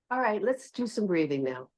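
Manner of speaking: gentle, hushed invitation; soft invitation. Shown as a demonstration. Yoga teacher showing the kinder tone to her class, soft and gentle. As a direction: invite them softly into breathing. Soft take of the same instruction.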